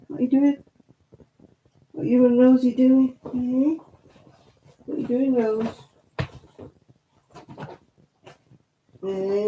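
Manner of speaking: high, sing-song cooing at the dog; pet talk. Acted. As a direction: coo sweetly to your pet like a baby. Cooing to her pets.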